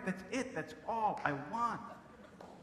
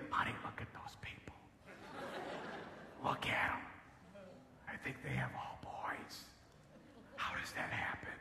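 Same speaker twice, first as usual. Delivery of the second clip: distant onlookers; 3 semitones higher, 11 dB quieter than usual. Retold or imitated. Onlookers whisper about the comedian's family, hushed. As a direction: whisper it like onlookers remarking from afar. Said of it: "people off in the distance".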